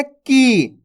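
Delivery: "की" with a long, held ee; drawn-out vowel. Shown as a demonstration. Teacher showing the long vowel on का, drawn-out "की". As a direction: stretch the ee of "की". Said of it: "खींच खींच के उच्चारण करना है".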